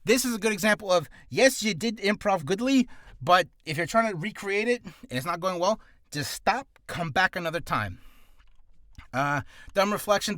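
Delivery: ordinary delivery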